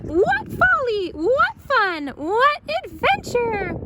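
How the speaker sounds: high, slow, gleeful exclaiming; thrilled. A narrator's reading. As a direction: savour every thrilling word. Baby thrilled.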